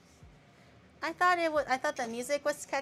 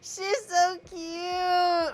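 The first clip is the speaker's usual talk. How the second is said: teary gushing; 6 semitones higher, much slower than usual. Real, not acted. Overwhelmed by cute video.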